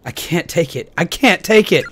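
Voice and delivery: rising from firm to full yell; building to a scream. Acted. Game character losing control.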